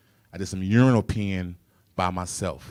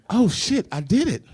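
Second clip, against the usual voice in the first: amazed realization; 10 semitones higher, 4 dB louder than usual. Retold or imitated.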